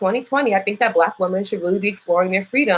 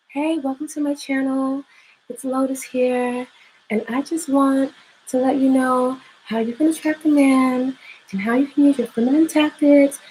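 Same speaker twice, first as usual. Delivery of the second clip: higher-pitched, slowed mock YouTuber intro; 7 semitones higher than usual. YouTuber greeting her channel with dating advice, fake high voice. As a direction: parody an artificial, sped-up YouTuber voice. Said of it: "so that they sound more like this".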